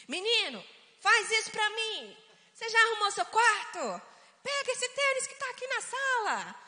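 high, loud scolding orders; harsh parent. Shown as a demonstration. Parent ordering son to tidy up, harsh. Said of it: "aquela forma do pai falando com o filho".

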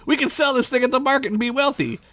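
high eager voice; eager boy. Acted. Derek pitching his plan to Squeak, high and eager. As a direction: pitch it up with excitement. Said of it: "Derek plans".

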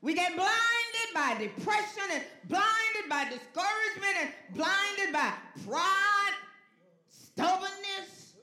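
loud, high, drawn-out sermon cry; full-force preaching. Real, not acted. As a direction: preach it loud, stretching each key word.

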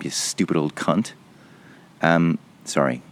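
ordinary talk, nothing marked

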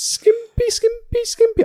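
high, teasing repeated "skimpy"; silly high-pitched singsong. Real, not acted. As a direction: tease it up high like a playground chant.

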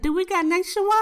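high-pitched comic panic; shook. Retold or imitated. Asking mom on the phone about insurance, shook. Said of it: "I was, like, so shook".

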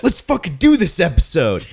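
high, loud laughing yell; mock rallying cry. Teasing co-host about a derailed episode, mock rallying cry. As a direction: whoop it up like a fake pep talk.

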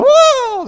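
loud, high-pitched party holler; mock "whoa" of someone cutting loose; high wild whoop. Imitating college kids going crazy.

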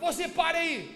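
loud, high mock command to halt; shouted police order. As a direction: order a suspect to stop. Policiais mandam o homem parar. (ordem gritada de policial)

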